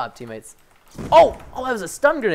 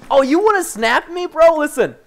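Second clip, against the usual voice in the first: higher, cocky taunt at the enemy; mock taunt; 7 semitones higher, 3 dB louder than usual. Real, not acted. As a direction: call him out like a cocky rival.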